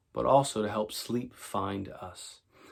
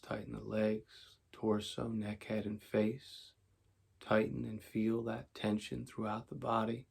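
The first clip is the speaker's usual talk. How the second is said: calm, unhurried meditation voice with long pauses; guided meditation; 7 dB quieter than usual. Acted. Teacher guiding tension and relaxation before sleep, calm meditation voice. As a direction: talk them softly into letting go.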